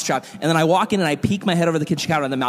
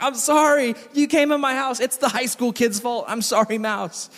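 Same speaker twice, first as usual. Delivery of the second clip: raised, pleading apology to the mouse; mock apology; 7 semitones higher than usual. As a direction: apologise in a higher, hammed-up voice. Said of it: "And I'm like".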